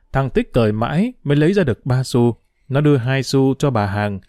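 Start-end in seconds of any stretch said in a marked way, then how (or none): none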